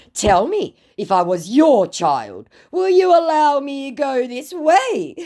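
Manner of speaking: high, drawn-out pleading voice; mock pleading scammer. Scammer guilt-tripping the narrator, high mock pleading. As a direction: whine it like a sulky kid. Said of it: "scammer trying to manipulate".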